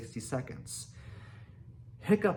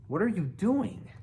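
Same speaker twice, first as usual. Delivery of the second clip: higher, curious question; 7 semitones higher than usual. A narrator's reading. Fish Legs questions Hiccup's dragon talk, curious. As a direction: ask with puzzled curiosity, pitched up. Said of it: "asked Fish Legs curiously".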